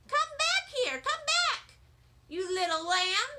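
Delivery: high, loud playful calling. A narrator's reading. Sheldon calling to Lucille while chasing her, high playful calling. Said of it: "Sheldon was calling to her".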